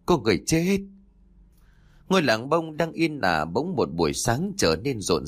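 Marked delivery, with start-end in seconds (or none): 0.0-0.8 high cry of alarm; alarmed yell. A narrator's reading. Fleeing villagers announce a death, alarmed yell. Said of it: "vừa chạy vừa hét".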